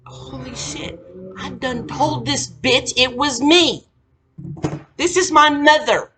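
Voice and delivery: high, loud, slow disbelief; building from hushed to outraged; mock shocked realization. Daughter realizing mom told everyone, loud shocked disbelief. Her own shocked reaction retold.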